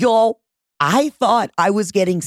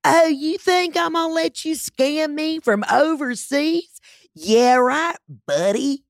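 high, drawn-out sassy voice; mock indignant women; 10 semitones higher, slower than usual. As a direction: imitate women smugly dismissing a scam caller.

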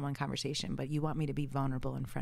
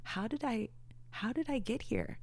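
higher-pitched, wondering inner voice; disbelieving self-doubt; 7 semitones higher than usual. Retold or imitated. Photographer voices her worthiness doubts, in disbelief. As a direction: voice your own doubt in small, high questions.

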